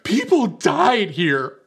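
loud, high, slow mock outrage; hammed-up tearful fury, each word stretched; really mad. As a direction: imitate a furious girl yelling. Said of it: "she's really mad".

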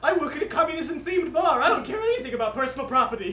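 loud, fast, cheerful bartender voice. Acted. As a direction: boom it out like a carefree bartender.

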